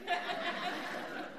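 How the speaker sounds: a short airy laugh at his own aside; breathy laugh. Real, not acted. Preacher chuckling at his own joke, short airy laugh. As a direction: let a quick laugh slip out.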